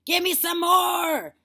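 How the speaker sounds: very high, drawn-out shout; demanding cry. A narrator's reading. Grandma demanding medicine, slow loud cry. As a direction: demand it at the top of your voice. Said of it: "she cried".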